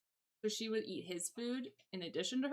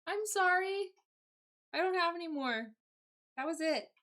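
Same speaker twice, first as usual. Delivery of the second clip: pet talk; 9 semitones higher, 4 dB louder than usual. Real, not acted. Apologizing to the cat.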